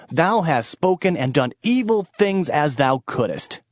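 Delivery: ordinary talk, nothing marked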